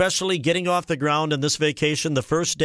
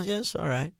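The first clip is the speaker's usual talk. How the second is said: much softer, deadpan; barely bother to answer; bored teen mumble; 8 dB quieter than usual. Retold or imitated. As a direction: son on the parasail, in an unimpressed mumble. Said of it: "I go".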